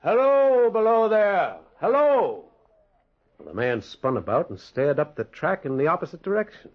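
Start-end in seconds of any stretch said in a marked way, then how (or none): 0.0-2.4 long, high, loud hail; calling out. Acted. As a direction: call down to someone far below. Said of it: "I cried".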